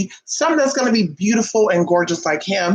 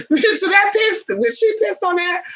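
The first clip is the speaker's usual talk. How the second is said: mock squeal; 13 semitones higher than usual. Mocking shrill outburst.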